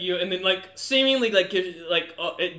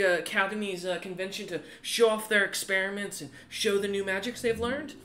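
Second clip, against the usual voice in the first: lightly voiced chatty woman, higher pitch; higher, talkative woman character; friendly woman official; 6 semitones higher than usual. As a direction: lift the voice a little for a sociable host. Game master voices chatty woman.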